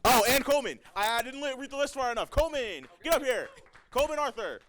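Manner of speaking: high, loud, flustered announcing; loud excited call. Real, not acted. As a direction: call him up to the stage. Forgot a winner, calling him up.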